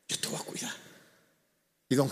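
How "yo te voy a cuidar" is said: whispered promise. Retold or imitated. Promise to care for old man.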